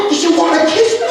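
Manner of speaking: very high-pitched giddy disbelief; mock freak-out, voice shot way up. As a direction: squeal the question like an overexcited kid. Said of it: "I was freaking out".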